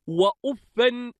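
وأفا ending in "an", "uffan"; accusative tanwin. Shown as a demonstration. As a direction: say وأفا as "wa-uf-fan". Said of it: "بالنصب والتنوين".

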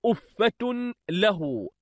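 slow أفة له, with أفة ending in "-tun"; feminine nominative tanween. Shown as a demonstration. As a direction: say أفة له slowly as "uffatun lahu". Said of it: "مؤنثة مرفوعة".

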